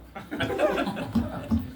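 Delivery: breathy burst of laughter; high airy laugh. Real, not acted. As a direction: laugh lightly at the absurdity. Laughing at the stamp joke.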